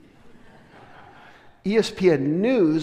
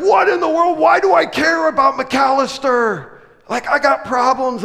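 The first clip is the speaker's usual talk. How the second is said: much higher and louder, baffled at himself; incredulous; 9 semitones higher, 8 dB louder than usual. Retold or imitated. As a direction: exclaim in comic disbelief. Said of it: "I'm like".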